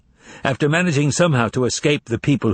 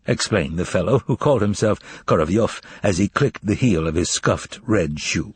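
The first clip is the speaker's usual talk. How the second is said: usual speaking voice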